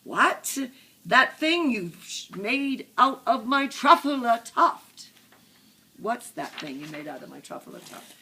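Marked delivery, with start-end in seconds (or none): 0.0-5.1 loud, high, outraged demand; upset shout. A narrator's reading. Lorax demands to know about the truffula tuft, upset shouting. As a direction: shout it, indignant, hitting each word hard. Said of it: "as he shouted and puffed".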